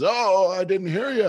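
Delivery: high, loud mock surprise; oblivious politician. Joe Biden answering Boris, high mock-apologetic outburst. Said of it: "And he goes".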